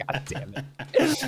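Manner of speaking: usual speaking voice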